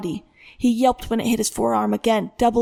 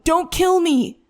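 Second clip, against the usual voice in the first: high, frantic plea; sobbing scream; 7 semitones higher, 3 dB louder, slower than usual. A narrator's reading. Son begs father to stop beating him, screaming. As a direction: beg for your life in a scream. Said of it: "he screamed, sobbing".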